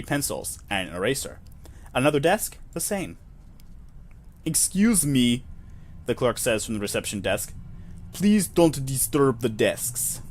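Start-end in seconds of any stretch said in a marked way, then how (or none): 4.4-5.4 slow, stretched fake Russian accent; faux Russian accent. Clerk calling from reception desk, slow faux Russian accent. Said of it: "a, faux Russian accent". 8.1-10.2 slow, stretched fake Russian accent; faux Russian accent. Clerk calling from reception desk, slow faux Russian accent. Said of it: "a, faux Russian accent".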